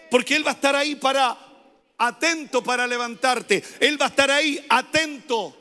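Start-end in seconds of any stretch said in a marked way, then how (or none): none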